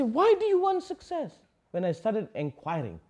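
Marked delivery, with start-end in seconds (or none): 0.0-1.4 high, pointed question to a student; challenging question. Retold or imitated. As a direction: challenge them lightly. Master questions success seekers.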